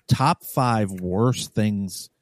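slow emphasis. Real, not acted. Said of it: "slow emphatic list".

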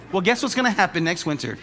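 normal talking voice

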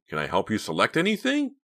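high, chirpy salesman offer; cheerful helpfulness; cheery. A narrator's reading. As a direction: offer help brightly, pitch rising. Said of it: "he yelled cheerily".

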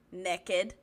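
"naked" said as NEK-id; joke pronunciation. Acted. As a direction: say "naked" with a short e, like "nekkid". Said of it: "Is that really how you say it".

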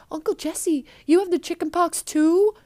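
disguise slipping, lightly voiced high girl; Stephanie. Girl forgets her disguise to question her uncle, lightly voiced. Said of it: "Stephanie kinda breaks this Karen character".

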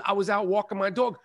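normal talking voice

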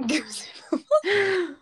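high, slow laughing question; laughing disbelief. Real, not acted. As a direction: laugh through the words. Laughing at odd word.

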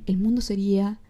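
ordinary talk

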